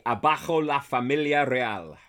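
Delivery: loud, declaiming Spanish line. Shown as a demonstration. Spanish, announced to Brienne. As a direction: proclaim it in Spanish. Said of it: "in Spanish, you're gonna say".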